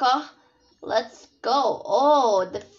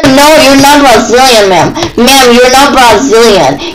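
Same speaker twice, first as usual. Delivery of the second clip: very loud yelling at usual pitch; shouted denial; 19 dB louder than usual. Pretend. Player denying another student council member is Brazilian, full shouting. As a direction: blast the words out at full volume.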